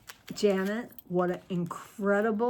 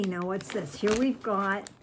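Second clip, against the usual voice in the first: usual speaking voice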